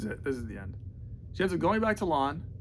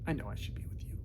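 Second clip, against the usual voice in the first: unvoiced, softer repeat; whisper; 8 semitones lower, 10 dB quieter, faster than usual. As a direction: hush it like a private confession.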